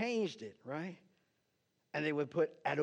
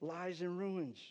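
slow, raised, impassioned prayer reading; fervent prayer; 4 semitones higher, slower than usual. Acted. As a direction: plead with God, drawing out each O Lord. Pastor reads Daniel's prayer aloud.

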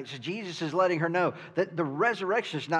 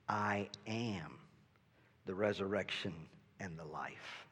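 lower, soft, solemn declaration; solemn Jesus; 6 semitones lower, 12 dB quieter than usual. Acted. Jesus declaring himself to Martha, lower and hushed. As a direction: announce it quietly with deep reverence.

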